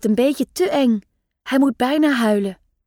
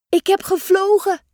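proud; 7 semitones higher than usual. A narrator's reading. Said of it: "zegt Eefje trots".

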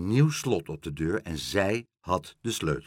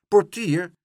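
high, loud call; 8 semitones higher, 8 dB louder than usual. A narrator's reading. Meneer Pen confronting the porter, calling out sharply.

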